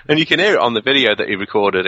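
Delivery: ordinary talk, nothing marked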